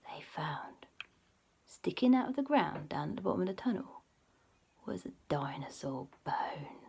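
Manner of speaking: soft, unvoiced storyteller's hush; hushed spooky narration; suspense whisper. A narrator's reading. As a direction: whisper the big reveal slowly.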